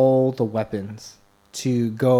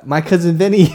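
laughing triumph; 6 semitones higher, 7 dB louder than usual. Real, not acted.

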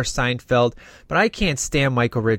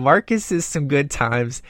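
normal talking voice

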